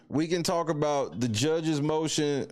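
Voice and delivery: usual speaking voice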